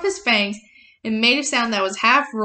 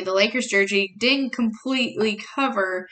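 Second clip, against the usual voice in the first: ordinary delivery, nothing marked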